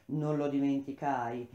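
ordinary delivery

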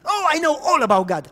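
high, loud dismissive snap; girl scoffing that she knows God; scornful retort. Retold or imitated. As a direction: brush him off scornfully.

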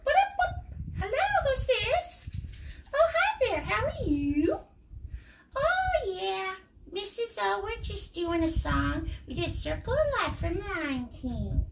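high, playful toy-bear voice taking a call; high toy bear voice on a pretend phone call. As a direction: play the teddy bear chatting on the phone. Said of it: "Bear goes".

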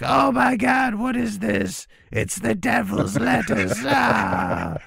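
very high shrieking monster voice; shrieking demon. Pretend.

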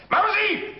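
lauter, hoher Ruf nach Mausi; laut durch die Wohnung rufen. Acted. Mann ruft laut nach Frau. (laut rufend)

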